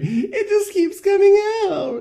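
very high, slow, wailing mock cry; high slow exaggerated sobbing wail; hammed-up sobbing. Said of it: "in a slump on the floor going like".

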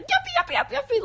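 very high, nasal, chirpy; squeaky nasal cartoon voice; nasally character voice. Shown as a demonstration. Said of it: "nasally voice".

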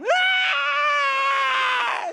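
long, very high loud shriek; piercing staged scream; mock child's scream. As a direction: scream bloody murder, held long. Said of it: "So she screams".

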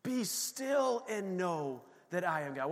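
softly and slowly, "Be still" stretched out; calm and slow. Shown as a demonstration. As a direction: let the breath settle, then speak gently. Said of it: "Take a breath".

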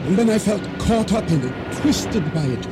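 breathless climax. Acted. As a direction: build the panic of being swept under. Narrator's climax of explosion and drowning.

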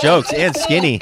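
ordinary delivery

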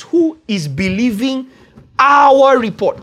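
"our" rises sharply high and loud, said slowly; stress on our. Shown as a demonstration. Preacher punching "our" to show the congregation the question. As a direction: ring out "our" to show the change of focus. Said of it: "The question is".

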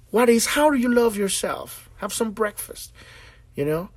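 usual speaking voice

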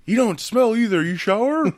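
loud high teasing voice; mock them like a bully. Mocking nerd stereotypes.

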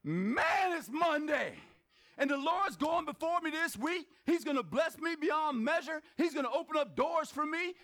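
high, rousing Monday declaration; loud, fired-up enthusiasm. Acted. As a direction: greet the week like you can't wait. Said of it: "You don't have to be that loud".

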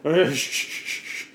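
loud, repeated hushing; comic string of shushes; urgent shushing. Acted. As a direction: shush someone hurriedly before they say too much. Shushing talk of Assassin's Creed.

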